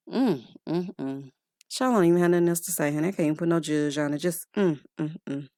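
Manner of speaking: soft low headshaking mm; speechless disapproval. Real, not acted. Host lost for words at Alexia, soft mm. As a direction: hum your disapproval.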